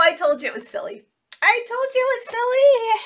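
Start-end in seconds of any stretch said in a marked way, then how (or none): 1.4-3.0 excited. Acted.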